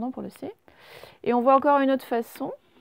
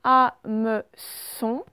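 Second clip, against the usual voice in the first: Hameçon stretched very slowly, ending on a rise; slow dictation; 5 dB louder, much slower than usual. Shown as a demonstration. Teacher sounding out Hameçon for pupils. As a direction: pull Hameçon apart slowly to show the syllables. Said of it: "c'est dans la dernière syllabe".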